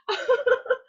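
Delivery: a bright burst of laughter through tears; tearful laugh. Real, not acted. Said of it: "I can't believe I'm crying like this".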